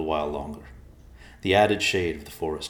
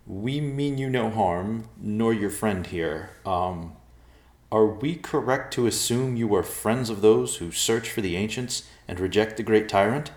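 tentative, higher-pitched formal appeal; nervous diplomacy; 5 semitones higher, 4 dB louder than usual. A narrator's reading. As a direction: placate the bears very politely. Nervous man addresses talking bears.

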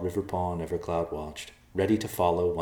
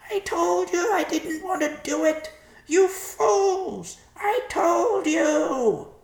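very high, loud squawking reproach; crying-out vulture; 6 dB louder, much slower than usual. A narrator's reading. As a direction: shriek blame in a bird voice. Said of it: "the vulture cried".

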